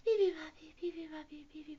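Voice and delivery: high chirps fading away; drowsy baby bird. A narrator's reading. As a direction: birdsong winding down. Bird settles down for nap.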